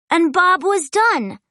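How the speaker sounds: usual speaking voice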